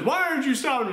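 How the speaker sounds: lightly voiced high mom; worried mom. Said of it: "and the mom says".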